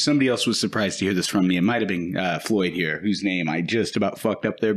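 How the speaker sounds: ordinary talk, nothing marked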